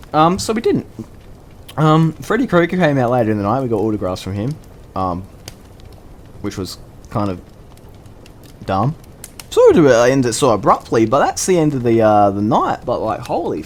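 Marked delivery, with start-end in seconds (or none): none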